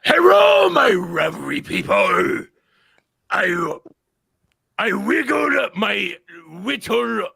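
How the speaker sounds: loud, slow mock Japanese accent, L's as R's; angry samurai voice. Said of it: "read it in angry Japanese samurai voice".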